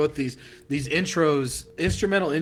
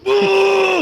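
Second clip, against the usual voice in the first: high, loud jeering boo; mock boo; 11 dB louder than usual. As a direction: boo him off the stage. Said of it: "I was over here like".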